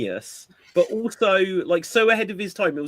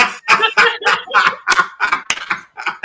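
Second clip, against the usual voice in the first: big high laugh; 7 dB louder than usual. Real, not acted. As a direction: burst of loud laughter. Laughing at the prank story.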